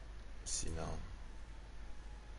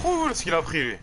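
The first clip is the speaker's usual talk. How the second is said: excited; 5 semitones higher, 8 dB louder than usual. Real, not acted. Gamer cheering a big hit.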